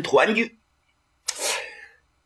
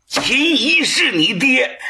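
high, slow, incredulous question; shocked disbelief; 10 semitones higher, slower than usual. Acted. Old prince stunned to learn Qin Qiong's father, asking in disbelief. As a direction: gasp it out as if struck. King shocked at hearing the name.

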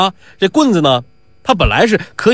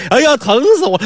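high, hammed-up yelp; put-on agony, pitched up; faked pain cry; 9 semitones higher, faster than usual. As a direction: cry out as if the leg hurts. Faker cries out in pain.

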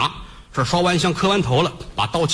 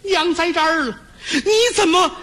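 high, tearful mother's wail, voice breaking; mother crying; 12 semitones higher than usual. Acted. Mother weeps to her son at the execution ground, high crying wail. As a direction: sob out to the condemned son. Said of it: "眼泪儿是哗哗的止不住了".

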